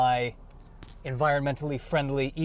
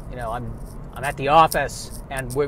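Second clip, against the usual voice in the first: ordinary talk, nothing marked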